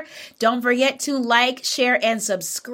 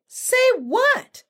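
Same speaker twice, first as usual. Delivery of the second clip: very high, clueless question; playing innocent; 14 semitones higher than usual. Bush Deer baits Anansi, high innocent act. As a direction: ask it in a high, guileless little voice.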